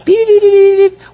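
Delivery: high, loud robotic beeps like a Martian antenna; playful sci-fi antenna beeps; antenna beeping. Pretend. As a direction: beep it out like a little radio signal. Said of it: "my spiritual antennas up".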